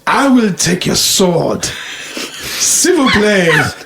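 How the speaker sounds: slow, drawn-out hammed-up French accent. Joke teller voices the Frenchman asking for a sword, hammed-up French accent. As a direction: put on your best French accent, stretching the words. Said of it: "That was my best French accent".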